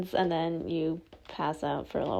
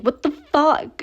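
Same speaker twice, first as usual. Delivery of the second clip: mock shocked crowd; 8 semitones higher, 9 dB louder, slower than usual. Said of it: "everyone's like".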